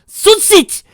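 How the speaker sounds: very high loud scream; horrified scream. Acted. Mother screaming at her bound daughter. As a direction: scream her name in horror. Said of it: "Fuller screamed in horror".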